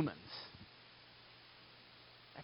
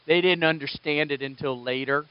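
ordinary talk

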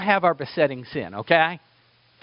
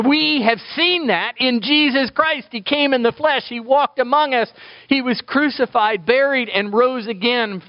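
raised voice, higher pitch throughout; impassioned preaching; 9 semitones higher than usual. Real, not acted. Preacher proclaiming the gospel to the congregation, impassioned. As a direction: proclaim it with rising fervor.

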